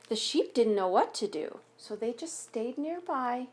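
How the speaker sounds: put-on gentle read-aloud voice for children; story narration. A narrator's reading. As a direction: read it like a picture book at story time. Reads picture book aloud.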